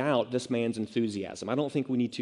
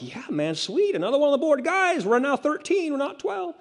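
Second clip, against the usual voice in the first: very high, squealing hype; mock enthusiastic Jesus; 14 semitones higher than usual. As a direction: cheer a new recruit in an exaggerated falsetto. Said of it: "not met with an enthusiastic".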